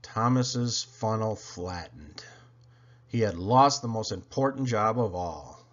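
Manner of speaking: plain reading voice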